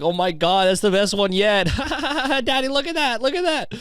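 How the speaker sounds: high, giggly mock child voice pointing and laughing; gleeful high-pitched child impression; giggling kid. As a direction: squeal it like a delighted kid with a laugh in it. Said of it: "kids are just giggling".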